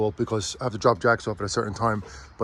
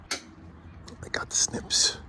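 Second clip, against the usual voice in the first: whisper; 10 dB quieter, much slower than usual. Real, not acted. Quiet aside while opening box.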